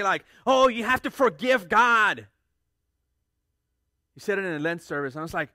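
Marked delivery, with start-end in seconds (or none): 0.4-2.3 imitating a local preacher. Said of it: "a local preacher here say".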